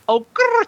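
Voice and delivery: very high "oh, grrr" with rolled r; playful trill. Pretend. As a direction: squeal "oh, grrr" with a fluttering r. Joke name for the next chip.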